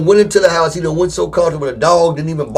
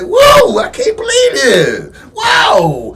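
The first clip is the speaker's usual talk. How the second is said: mock amazed cry; much slower than usual. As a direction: squeal like no one's treated you this good. Imitating overwhelmed delight.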